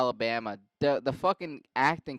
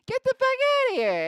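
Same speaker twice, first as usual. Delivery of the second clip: very high, shrieked mock Boston; yelling Boston wife; 5 dB louder than usual. Wife throwing out her husband's friends, high Boston shriek. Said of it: "She says".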